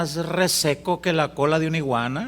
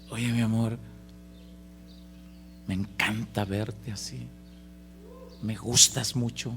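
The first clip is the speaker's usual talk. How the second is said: soft, lower, slow declaration; tender; 5 semitones lower, 6 dB quieter, slower than usual. Pretend. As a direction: say it tenderly to your wife. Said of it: "le dijiste". (un esposo tierno hablándole a su esposa)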